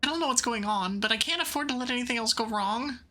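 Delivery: ordinary talk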